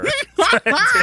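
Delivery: sudden high cackle of disbelief; real laugh of disbelief; shocked laugh. Real, not acted. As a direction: burst out laughing, high and loud. Burst of laughter at tweet.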